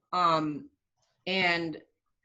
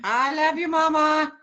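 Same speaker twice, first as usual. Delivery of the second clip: playful shout; 8 semitones higher, 9 dB louder than usual. Acted. Son answers mom playfully, loud.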